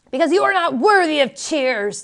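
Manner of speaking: angry accusation. A narrator's reading. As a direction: raise your voice in scorn at her.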